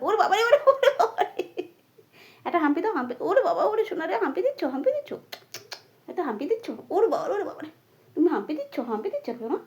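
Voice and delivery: cooing at her parrot with a laugh in it; high cooing delight. Real, not acted.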